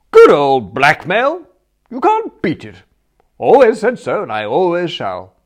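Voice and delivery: loud, exultant, booming; hearty triumphant cry; gleeful aunt. Acted. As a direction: crow over the scheme like a delighted aunt. Aunt Dahlia gloating over blackmail.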